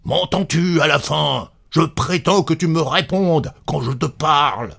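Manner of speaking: high, forceful demand; exasperated anger. A narrator's reading. Husband gripping his silent wife's arm, exasperated. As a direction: insist angrily on being answered.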